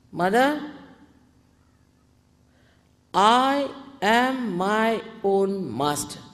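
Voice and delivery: slow, loud, drawn-out affirmation; full confidence. Shown as a demonstration. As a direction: declare it firmly, stretching each word. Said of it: "say with full confidence".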